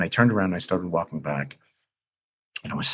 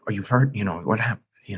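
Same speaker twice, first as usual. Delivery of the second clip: higher, hurried imitation of a frantic mother; retold panic; 5 semitones higher than usual. Mother checking her tearful child, imitated higher and panicky. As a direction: echo mom's worried questions, a touch panicked. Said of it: "went into full panic mode".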